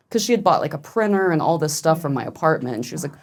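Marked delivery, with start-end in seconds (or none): none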